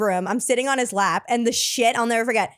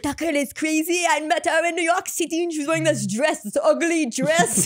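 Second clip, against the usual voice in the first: put-on higher French-accented disdain, slowing at the end; 4 semitones higher than usual. Frenchman gossips to his girl, scornful French accent. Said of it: "He's like".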